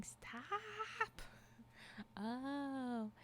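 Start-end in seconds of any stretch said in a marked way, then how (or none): 0.0-1.2 high, drawn-out yell held back in volume; mock yell. Streamer voicing the shaking character, high mock yell. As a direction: stretch it out as a small, high pretend scream. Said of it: "just imagine he's yelling".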